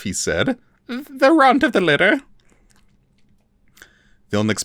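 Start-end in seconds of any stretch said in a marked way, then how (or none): 0.9-2.2 much higher timid elf voice; small frightened creature pleading; Forficule voice. A narrator's reading. The captive elf lies to his torturer, high and timid.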